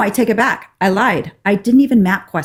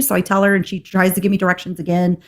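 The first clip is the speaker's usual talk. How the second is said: ordinary delivery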